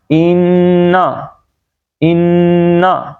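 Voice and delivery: "انا" with long humming nn, short end; ghunna only. Shown as a demonstration. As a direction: hold the n of "انا", don't draw the last vowel. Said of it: "گنا کریں گے بس".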